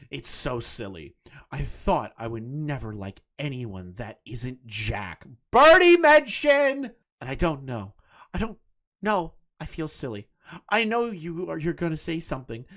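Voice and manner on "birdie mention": high, loud, drawn out cheer; excited shout. Real, not acted. Streamer cheering when her name comes up. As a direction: shout it out, thrilled at the mention.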